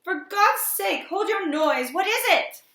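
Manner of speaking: high, exasperated shout; urgent, high-pitched yelling; loud cry. A narrator's reading. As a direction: shout desperately over the screaming. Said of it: "hardly less loud".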